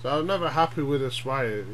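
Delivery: ordinary talk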